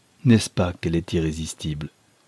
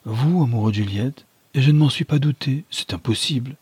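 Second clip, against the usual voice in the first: astonished exclamation; 6 semitones higher, 3 dB louder than usual. A narrator's reading. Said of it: "s'écria madame Nélis".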